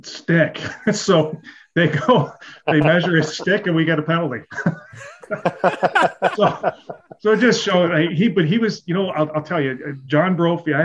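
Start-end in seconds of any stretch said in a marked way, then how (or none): 0.0-0.6 deadpan demand. Retold or imitated. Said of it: "all you hear is". 0.8-8.3 laughing through it. Real, not acted. Laughing at his own punchline.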